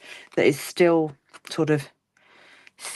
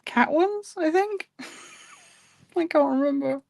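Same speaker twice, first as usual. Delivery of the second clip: high uncertain; 11 semitones higher than usual. Real, not acted. Unsure, laughing at herself.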